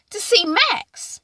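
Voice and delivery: coy reply. Retold or imitated. Nina's coy excuse.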